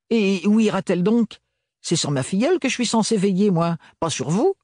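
indignant aunt. A narrator's reading. Tante Roseline objects to being separated from her niece, indignant. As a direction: protest like a prim guardian aunt.

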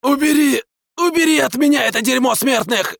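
very high, fast, panicked hoarse voice; terrified rasp. Acted. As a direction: croak it out in horror. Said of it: "прохрипел он, в ужасе".

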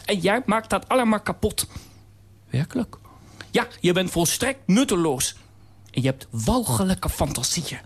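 high, harsh toy voice; cold nutcracker scolding in a high voice. Acted. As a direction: put it down from a high perch.

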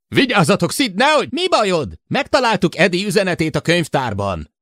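high, loud frantic yell. A narrator's reading. Jill and Sid yelling at each other over the radio. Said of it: "felordított".

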